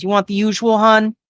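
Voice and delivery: high, familiar woman's voice; waitress taking order. Acted. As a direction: ask a regular in a high waitress voice. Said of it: "And kinda goes".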